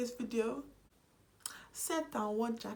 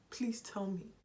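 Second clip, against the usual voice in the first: unvoiced pleading whisper; 3 dB quieter than usual. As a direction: whisper the plea to the camera. Rhetorical plea to viewers.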